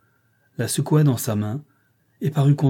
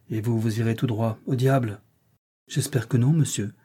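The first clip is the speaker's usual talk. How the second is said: ordinary delivery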